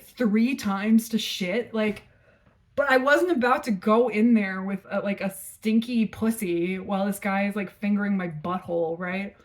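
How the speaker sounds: usual speaking voice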